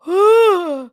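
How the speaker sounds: loud sharp gasp of shock. Real, not acted. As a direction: gasp loudly in horror. Shocked by the dress.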